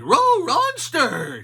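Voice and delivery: very high, loud dog cry; Scooby crying out. A narrator's reading. Scooby crying out in the snow, high and loud. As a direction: bark it like a panicked cartoon dog.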